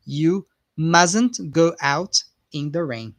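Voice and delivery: slow line with "mustn't" said musn't; careful slow model sentence; slow model, silent t. Shown as a demonstration. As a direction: lay it out slowly, no t in "mustn't". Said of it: "the T is not pronounced".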